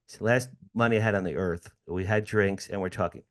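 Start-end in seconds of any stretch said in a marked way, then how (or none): none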